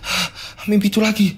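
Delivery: acted panting after a nightmare; ngos-ngosan ketakutan. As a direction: gasp awake, speak between frightened breaths. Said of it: "Napasnya terus menerus. Ngos-ngosan Sekar ini". (terbangun terengah-engah ketakutan)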